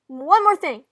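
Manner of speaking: loud, high afterthought; projected, dramatic announcement; calling back. Acted. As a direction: call it out across the room. Sister calls out on returning.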